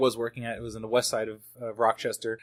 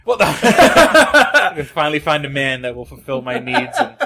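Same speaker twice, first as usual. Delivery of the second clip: loud, slow, laughing through the joke; 9 dB louder than usual. Pretend. Joking back when co-host teases about getting laid, laughing. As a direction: play along, cracking up as you speak.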